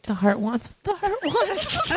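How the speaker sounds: mock solemn.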